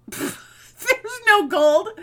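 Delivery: laughing it out, high and loud. Real, not acted. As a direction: laugh through the words. Laughing about the fake gold.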